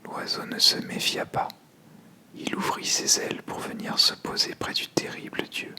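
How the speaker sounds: usual speaking voice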